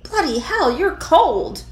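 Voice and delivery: high, loud startled outcry; startled yelp. Acted. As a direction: jolt at the cold touch. Startled by cold body in bed.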